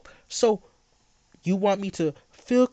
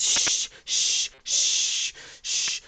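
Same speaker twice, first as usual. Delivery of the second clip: put-on whispered shushing, as to a baby; mock shushing; much slower than usual. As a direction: hush him like a fussing infant. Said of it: "because you're such a baby".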